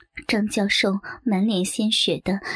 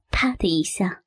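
usual speaking voice

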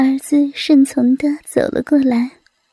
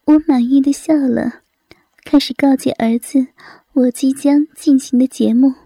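usual speaking voice

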